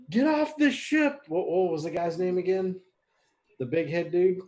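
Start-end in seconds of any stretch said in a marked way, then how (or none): 0.1-1.2 high, slow, loud warning call; shout. Acted. Player shouting to the imps while descending, high drawn-out shout. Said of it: "I'm gonna shout to the imps".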